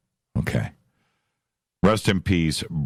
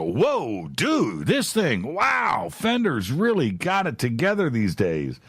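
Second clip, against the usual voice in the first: higher, hammed-up gushing guitar fan; mock amazement; 7 semitones higher, slower than usual. Host imitating fans gushing over the Fender guitars, mock amazed. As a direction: gush over the new gear like a wide-eyed fan. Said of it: "they're gonna go".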